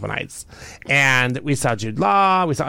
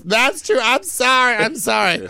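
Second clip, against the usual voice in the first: high, louder mock apology from a caught eavesdropper; imitating apologetic woman; 10 semitones higher, 3 dB louder than usual. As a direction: squeak out a sheepish sorry, hammed up.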